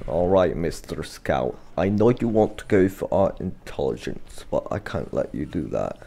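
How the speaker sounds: mock villain. Addressing enemy scout as villain.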